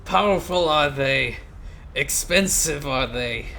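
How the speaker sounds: grand proclamation. Acted. A ruler unveiling his navy in a loud mock accent. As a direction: announce the new fleet like a pompous monarch. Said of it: "this horrid accent".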